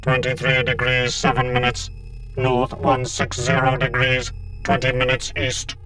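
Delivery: buzzing synthetic drone; robotic Cyberman. A narrator's reading. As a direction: recite data like a machine, evenly spaced. Cybermen exchange attack figures.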